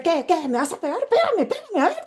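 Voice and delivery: rising challenge, pitched high and pushing; defiant dare. Retold or imitated. Brother defying shouting father.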